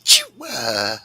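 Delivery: imitating a dainty high sneeze; coworker's cute sneeze with a giggle; cute little sneeze. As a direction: squeak out a tiny sneeze. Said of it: "she would go".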